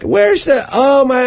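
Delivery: high imitated fretting; panicky lamenting. Mugged woman searches for her wallet, imitated panic. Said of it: "She was panicking and kind of lamenting".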